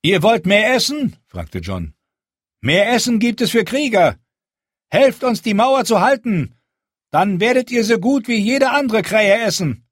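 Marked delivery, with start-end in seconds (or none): 0.0-1.2 high, loud and firm, a leader addressing a crowd; Jon's commanding speech. Acted. Jon rallies the hungry wildlings, firm and loud. 2.6-9.9 high, loud and firm, a leader addressing a crowd; Jon's commanding speech. Acted. Jon rallies the hungry wildlings, firm and loud.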